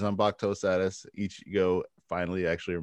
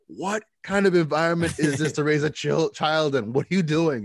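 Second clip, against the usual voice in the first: incredulous parent; 6 semitones higher, 4 dB louder than usual. Retold or imitated. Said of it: "You'd be like".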